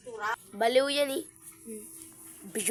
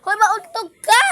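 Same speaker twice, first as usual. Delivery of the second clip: warning call; 8 semitones higher, 9 dB louder than usual. Real, not acted. Warning child loudly.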